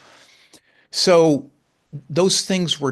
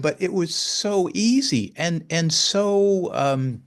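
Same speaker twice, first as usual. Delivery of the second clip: ordinary talk, nothing marked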